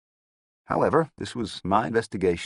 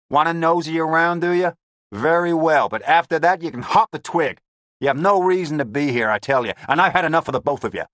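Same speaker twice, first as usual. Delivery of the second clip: loud, higher, fast gangster rant; irritated gangster; 10 semitones higher, 7 dB louder than usual. A narrator's reading. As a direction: rant like a fed-up boss. Lavelle fed up.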